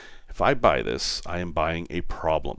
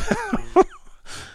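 high laugh. Real, not acted. Laugh after cheer.

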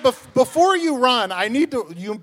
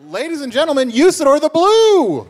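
announcer voice; 7 semitones higher, 8 dB louder than usual. Acted. Announcing the new co-host.

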